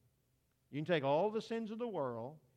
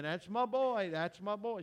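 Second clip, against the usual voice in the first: mock proud yell from the stands, louder and higher; proud mama screaming; 5 semitones higher, 5 dB louder than usual. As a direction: cheer like a mother whose boy hit a home run. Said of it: "She's the one screaming".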